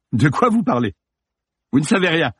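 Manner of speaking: high, defensive protest; alarmed denial. Acted. Actor crumbling under police accusation, alarmed denial.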